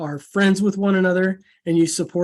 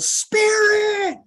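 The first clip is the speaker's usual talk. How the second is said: very high yelled answer; yelled reply. Retold or imitated. Consultant answering his call in the lobby, high yell. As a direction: yell it back as a rallying cry. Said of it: "And she yelled".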